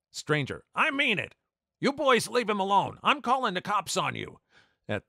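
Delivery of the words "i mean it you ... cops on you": much higher and louder stern yell; screaming at the bullies. Retold or imitated. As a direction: chase the boys off with a yell. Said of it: "starts screaming at the bullies".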